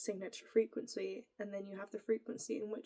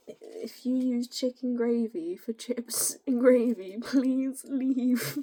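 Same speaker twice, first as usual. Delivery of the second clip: louder mock-stern warning, hammed up; 9 dB louder, slower than usual. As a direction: pretend to throw them out, joking. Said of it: "I'm joking".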